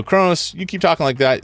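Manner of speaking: loud, high call-out. Retold or imitated. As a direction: shout it at Kronos. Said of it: "You go".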